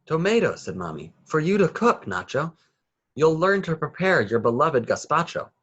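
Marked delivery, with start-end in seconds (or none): 0.1-0.6 excited announcement. A narrator's reading. Mommy announces tomatoes proudly. 1.2-5.5 excited mother. A narrator's reading. Mommy tells Nacho he'll cook.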